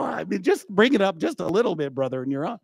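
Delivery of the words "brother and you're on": ordinary talk, nothing marked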